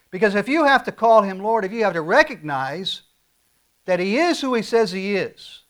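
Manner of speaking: full-force preaching. Acted. Pastor presses the congregation, preaching at full force. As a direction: preach it, lean into every word.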